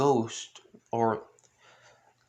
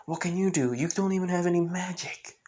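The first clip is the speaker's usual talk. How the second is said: higher-pitched, doubting retort; mildly acted disbelief, pitched up; incredulous challenge; 6 semitones higher, faster than usual. Magna challenges Asta in disbelief.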